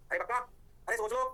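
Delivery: ordinary talk, nothing marked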